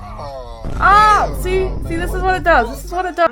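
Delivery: exasperated. Real, not acted. Frustrated at foundation.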